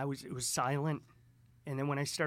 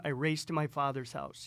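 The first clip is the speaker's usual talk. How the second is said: usual speaking voice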